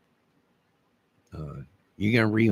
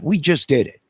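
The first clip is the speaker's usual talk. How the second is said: defiant boast; 6 semitones higher, 5 dB louder than usual. Real, not acted. Defiant boast after mock warning.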